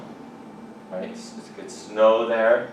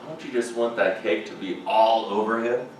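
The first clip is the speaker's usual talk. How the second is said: normal talking voice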